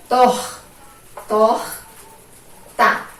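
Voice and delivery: "Tor. Tor. Tá." as isolated syllables with pauses; syllable separation. Shown as a demonstration. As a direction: sound out each syllable, breathed R closing "Tor". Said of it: "Final de sílaba".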